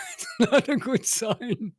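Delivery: high, slow words through laughter; laughing. Real, not acted. Caster joking about his poor fitness, laughing. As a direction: laugh it out, short of breath.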